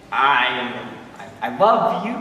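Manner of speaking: hesitant "I", then "I love you" lifting at the end; unsure "I love you", tentatively rising on "you"; phrased as a question. Acted. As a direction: stammer the "I" and make "you" a question. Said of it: "phrase it basically as a question".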